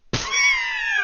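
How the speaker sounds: sudden high laugh; surprised high-pitched laugh; burst of laughter. Real, not acted. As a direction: laugh out at the chat. Reacting to chat message.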